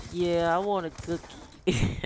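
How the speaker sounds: high, slow, whimpering little-kid imitation; hammed-up sniffly plea for a treat; mock crying. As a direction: put on a tearful, drawn-out whine. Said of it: "sitting there crying".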